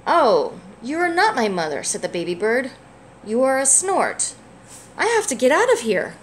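gentle, lively picture-book read-aloud; reading to a child. A narrator's reading. Parent reading a picture book to a child, gently lively. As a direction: read it warmly for a little one.

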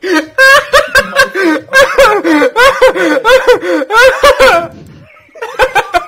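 high, loud, wheezing through laughter; can barely get it out for laughing; laughing hysterically. Real, not acted.

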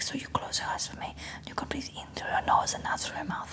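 very soft imitated masseuse whisper; hushed Spanish accent. Spanish masseuse guiding client's breathing, hushed accented whisper. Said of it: "like, this beautiful accent".

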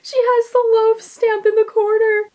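can't contain the excitement; thrilled squeal. Real, not acted. Cat got loaf of the day.

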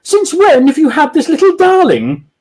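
high, loud falsetto posh woman; man's falsetto lady gushing; high-pitched lady voice. Acted. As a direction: gush at the little dog in a high voice. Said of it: "she added in a high-pitched voice".

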